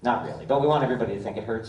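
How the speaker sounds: usual speaking voice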